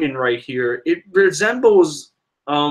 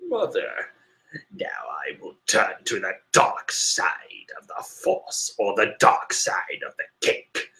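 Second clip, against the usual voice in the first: hissing snake voice; 8 semitones lower, 8 dB quieter than usual. Retold or imitated. Fan voicing Big Mom with a hissing snake voice. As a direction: hiss it out like a sinister serpent. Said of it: "Why did I just go snake".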